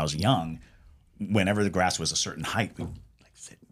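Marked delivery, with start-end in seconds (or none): 2.8-3.6 soft breathy hush; whispering. Retold or imitated. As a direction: whisper it like hiding. Secret grass-listening story.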